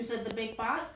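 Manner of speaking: plain reading voice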